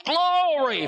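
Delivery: high, rousing sermon voice building to a shout; full-force preaching. Real, not acted. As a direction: preach it up to a triumphant, high-pitched cry.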